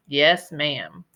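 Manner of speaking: usual speaking voice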